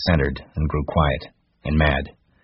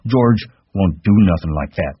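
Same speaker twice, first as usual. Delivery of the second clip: repeated, louder and higher; repeated insistence; 4 semitones higher, 6 dB louder than usual. A narrator's reading. Lenny repeating to Crooks, loud insistence. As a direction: dig in and repeat it. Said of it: "he repeated".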